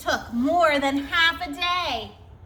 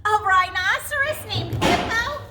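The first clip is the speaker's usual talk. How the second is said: amazed disbelief; 9 semitones higher, 4 dB louder than usual. Acted. Fluffy amazed, starting to laugh.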